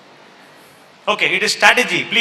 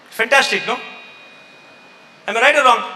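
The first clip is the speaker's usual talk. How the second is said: usual speaking voice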